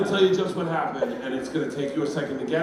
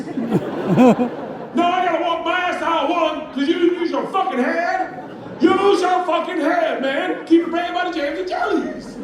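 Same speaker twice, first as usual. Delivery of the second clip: high, loud, hollered complaint; outraged rant; 13 semitones higher, 6 dB louder than usual. Acted. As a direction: berate the clerk at full volume. Acted shopper rant at store kid.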